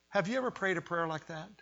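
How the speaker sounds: ordinary talk, nothing marked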